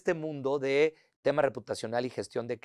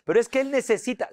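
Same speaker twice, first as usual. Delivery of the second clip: high, louder protesting voice; mock objection; 11 semitones higher, 7 dB louder than usual. Team member objecting to the boss's rule, mock protest. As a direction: imitate an insistent objector pushing back.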